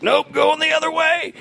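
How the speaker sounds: exaggerated high-pitched imitation; high-pitched quote. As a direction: squeal it out as the retold guy. Retelling a fleeing guy's reply.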